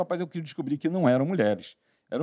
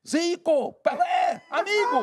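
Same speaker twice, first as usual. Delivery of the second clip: excited chief's son; much slower than usual. Retold or imitated. Chief's son excited over shirt.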